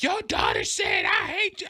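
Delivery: high-pitched imitation of an upset mother; freaking-out mom. Dad imitates mom reporting the daughter's words, worked-up high voice. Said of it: "she was bugging 'cause she was like".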